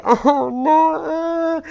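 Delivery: high, loud wailing; hammed-up sob of grief; mock crying. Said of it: "and then we cry".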